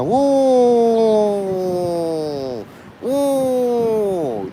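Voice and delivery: drawn-out, high-pitched falling hoot; long high woo. Pretend. Imitates a fire siren or whoop.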